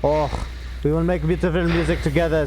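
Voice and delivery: hint of a cartoon French skunk accent, lightly voiced; lightly put-on French suitor purr; mock Pepe Le Pew. Teasing a friend about his French, light cartoon French accent. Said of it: "says Pepe Le Pew".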